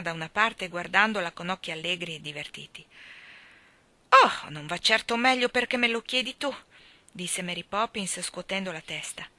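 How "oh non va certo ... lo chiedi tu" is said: higher, louder, huffy comeback; tart retort. A narrator's reading. Mary Poppins answering the starling, tartly. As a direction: put the cheeky bird in his place.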